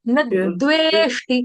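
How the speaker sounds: न द्वेष्टि with a push on the न; stress on न. Shown as a demonstration. Teacher correcting the class, stressing न. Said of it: "आघात कोई नहीं ले रहा".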